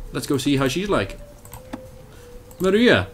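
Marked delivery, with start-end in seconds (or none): 2.6-3.1 comic impression. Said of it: "I was watching Pablo Francisco last night".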